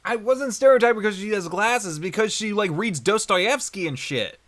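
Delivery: high, loud protest; indignant self-defence. Real, not acted. Defending against stereotyping accusation.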